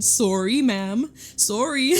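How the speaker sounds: playful, hammed-up Canadian "Sorry", rounded "sore-ee"; Canadian accent. Shown as a demonstration. Joking about her accent coming out, playful exaggerated Canadian accent. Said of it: "my Canadian accent".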